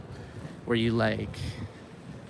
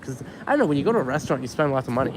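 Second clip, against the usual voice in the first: ordinary talk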